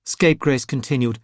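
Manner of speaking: ordinary delivery, nothing marked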